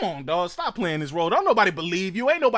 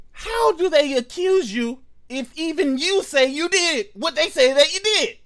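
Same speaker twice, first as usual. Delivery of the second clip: ordinary delivery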